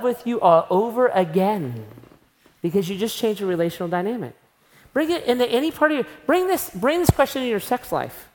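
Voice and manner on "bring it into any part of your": ordinary talk, nothing marked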